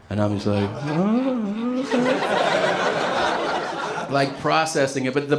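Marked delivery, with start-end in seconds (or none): 0.8-2.3 high, dazed little oh; stunned oh. Retold or imitated. Waking patient hearing about the overdose, dazed. 2.3-4.0 silent, airy laugh; breathless laugh. Real, not acted. Comic recalling the coma news, airless laugh.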